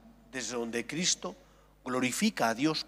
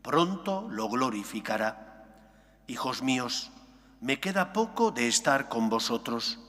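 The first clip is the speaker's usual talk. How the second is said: solemn Gospel proclamation, louder; liturgical reading; 2 semitones lower, 4 dB louder than usual. Acted. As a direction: proclaim it from the pulpit. Priest proclaims the Gospel aloud.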